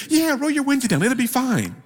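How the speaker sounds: high, breezy imitation of the driver; carefree driver. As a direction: toss it off like it's nothing. Driver answers cheerfully.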